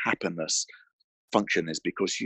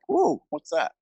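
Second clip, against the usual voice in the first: higher, sudden mock surprise; distracted wonder; 8 semitones higher than usual. Distracted brain looking out the window, pretend amazed. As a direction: get caught by something outside the window. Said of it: "be like".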